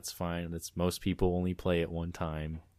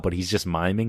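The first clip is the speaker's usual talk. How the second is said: ordinary talk, nothing marked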